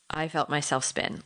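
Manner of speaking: usual speaking voice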